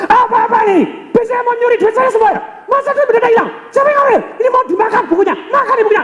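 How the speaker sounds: hammed-up bank customer yelling; mock furious customer yelling at teller; angry customer shout. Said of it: "dia marah".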